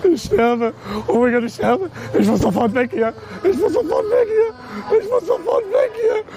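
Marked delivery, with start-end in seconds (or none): none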